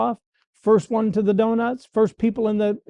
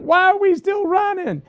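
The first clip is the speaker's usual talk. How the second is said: much higher, a whining protest; whiny complaint; 12 semitones higher than usual. Retold or imitated. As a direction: whine it like a tired lineman. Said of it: "You're like".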